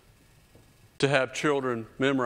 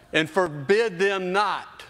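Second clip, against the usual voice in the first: emphatic; 6 semitones higher, 4 dB louder, slower than usual. Acted. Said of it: "reading scripture".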